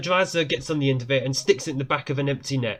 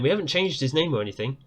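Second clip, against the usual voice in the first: ordinary talk, nothing marked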